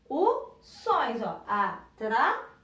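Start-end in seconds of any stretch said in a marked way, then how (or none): 1.5-2.5 "atrações" slowly split, with force on the last syllable; stress on final syllable. Shown as a demonstration. Teacher showing pupils the strong syllable, "atrações" with a forceful ending. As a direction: hit the "ções" of "atrações" harder. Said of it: "Falei ela com mais vontade".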